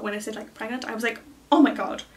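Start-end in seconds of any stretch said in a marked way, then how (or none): 1.5-2.0 loud, stunned exclamation; re-enacted shock. Woman seeing her positive pregnancy test, shocked. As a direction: act out the jolt of the news.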